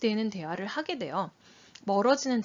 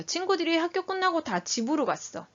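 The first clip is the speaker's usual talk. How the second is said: lightly voiced child reporting, higher pitch; child's higher-pitched telling, feeling left unclear; child's statement; 7 semitones higher than usual. Shown as a demonstration. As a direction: tell it as a child reporting to mom. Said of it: "지금 무슨 상황인지 모르겠죠".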